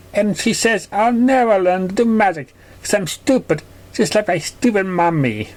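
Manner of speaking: put-on high, childlike and hurt; high young filly voice, a bit sulky; little filly voice. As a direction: play the small filly telling on a bully.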